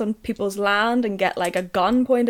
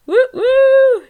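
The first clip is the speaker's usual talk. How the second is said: cheering; 9 dB louder than usual. Real, not acted. Mock cheer before next topic.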